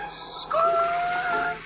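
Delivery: high-pitched drawn-out car skid noise; squealing "skrrt" sound effect; imitated tire screech. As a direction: mimic tires screeching to a stop. Imitates a car pulling up fast.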